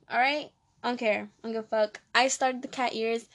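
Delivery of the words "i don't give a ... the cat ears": normal talking voice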